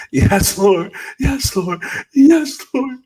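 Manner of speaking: very high, loud repeated cries, acted out; imitated screaming. Healed patient crying to God in the psych unit, screaming. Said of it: "I just kept screaming".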